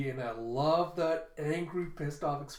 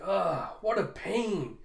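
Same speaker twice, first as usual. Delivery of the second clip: annoyed groan; 5 semitones higher, 4 dB louder, much slower than usual. Acted. Ulti annoyed at the task.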